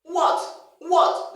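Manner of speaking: loud, high WHAT with an oo onset; demonstrative, indignant oo-what; provoked. Shown as a demonstration. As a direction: fire back what as if provoked. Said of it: "Someone provokes you, you try to say".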